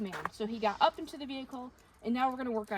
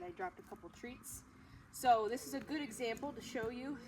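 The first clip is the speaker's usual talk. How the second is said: usual speaking voice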